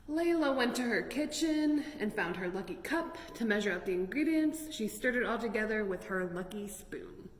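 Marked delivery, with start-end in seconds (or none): none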